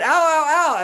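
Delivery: high, loud yelping ow ow; monkey yelps of pain. Retold or imitated. Monkey whose foot is stepped on yelps high and loud. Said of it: "And then they do it again".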